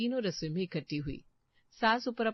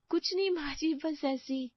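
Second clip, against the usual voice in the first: nervous; 8 semitones higher than usual. Acted. Caught daughter-in-law covering up.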